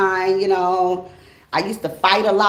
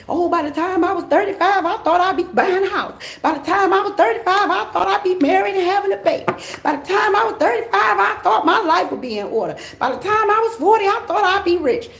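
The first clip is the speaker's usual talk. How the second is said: much higher, complaining voice of someone behind schedule; whiny disappointed follower; 10 semitones higher than usual. Retold or imitated. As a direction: list regrets like a let-down dreamer. Mocking followers' disappointed expectations.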